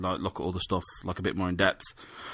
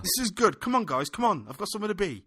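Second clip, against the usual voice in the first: impatient Joey impression; 10 semitones higher than usual. Voicing impatient Joey at the dogging.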